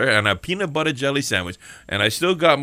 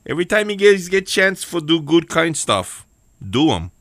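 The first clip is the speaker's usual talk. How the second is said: Hawaiian Pidgin reading. Acted. Pastor reading Ephesians in Hawaiian Pidgin, lively Pidgin voice. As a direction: read it like a local uncle in Pidgin. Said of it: "let me put on my lips".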